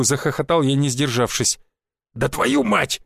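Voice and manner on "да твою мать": barked curse. A narrator's reading. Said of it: "гаркнул наставник".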